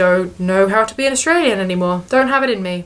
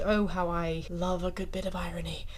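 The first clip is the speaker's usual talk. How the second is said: whispered, dry and ironic; a hushed wry whisper to the camera; sarcastic whisper; 3 semitones lower, 14 dB quieter than usual. Acted. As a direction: breathe it out as a sly aside.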